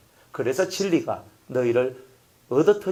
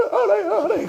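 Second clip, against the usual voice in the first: put-on high child voice pleading; a scolded child promising he knows; whimpering child. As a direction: answer like a sorry kid.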